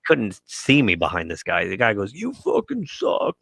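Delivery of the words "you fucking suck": drawn-out, high mock heckle; imitated heckler.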